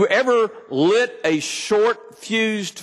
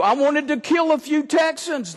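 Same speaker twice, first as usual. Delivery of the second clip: laughing, higher, half-shouted; laughing confession; 7 semitones higher than usual. Real, not acted. Preacher confessing road rage with a laugh. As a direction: laugh it out in mock outrage. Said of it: "I'm honest with you".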